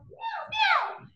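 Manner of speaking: cute high meow; high cat meow. Pretend. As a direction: meow like a little kitty. Imitating cat in song.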